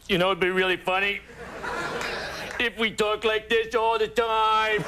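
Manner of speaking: a Kennedy mocking their own Boston accent. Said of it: "sitting around one night going".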